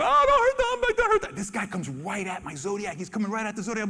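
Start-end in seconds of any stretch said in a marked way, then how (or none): 0.0-1.4 pleading tourists. Retold or imitated. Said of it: "the people go".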